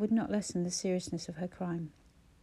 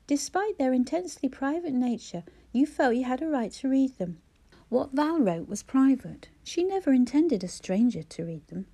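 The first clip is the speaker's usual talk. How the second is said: higher, louder, cold condemnation; sting her with every word; 4 semitones higher, 6 dB louder than usual. A narrator's reading. Jonah condemning Clara for reading private diaries, cold and stinging. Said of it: "His voice was cold and stinging".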